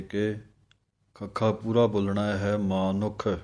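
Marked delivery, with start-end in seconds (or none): 2.6-3.3 ਮਾਨੁਖ with a clear u after the n and a full kh; correct pronunciation. Shown as a demonstration. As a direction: say ਮਾਨੁਖ as maa-nukh, the kh fully voiced out. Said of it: "ਪਾਠ ਬੋਲਣਾ ਹੈ ਮਾਨੁਖ". (ਮਾਨੁਖ ਦਾ ਸ਼ੁੱਧ ਉਚਾਰਨ)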